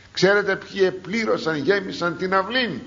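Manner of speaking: ordinary talk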